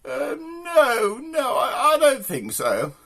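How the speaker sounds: high-pitched mock posh lady; posh woman. Posh woman declining the Range Rover, high put-on voice. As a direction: dither high, then refuse in posh tones.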